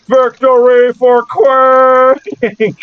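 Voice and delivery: high, drawn-out battle cry; war cry. Acted. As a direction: bellow it slowly as a creature's war cry. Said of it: "this war cry".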